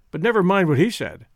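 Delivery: usual speaking voice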